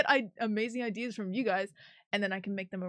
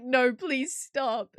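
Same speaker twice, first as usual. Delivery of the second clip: whiny plea; 4 semitones higher, 9 dB louder, much slower than usual. Retold or imitated. Maroon's whiny protest.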